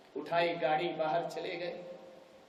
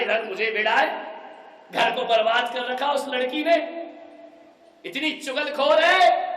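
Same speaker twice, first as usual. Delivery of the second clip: rising angry rant; 5 semitones higher, 6 dB louder than usual. Acted. Daughter rants about her sister-in-law.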